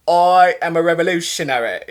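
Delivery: put-on proper British accent delivering a rally line; a lightly done British accent; mock British accent. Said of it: "giving that speech like".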